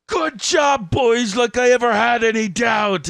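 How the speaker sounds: very high, loud, sarcastic pirate praise; hammed-up pirate voice. Quoting a pirate player's hammy boast to his crew. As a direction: sneer it like a smug cartoon pirate. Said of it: "It's so hammed up".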